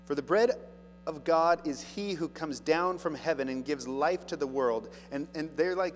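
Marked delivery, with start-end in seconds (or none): 0.1-4.9 raised, louder preaching read; scripture reading. Acted. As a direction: proclaim the verse to the congregation. Reads Jesus' words.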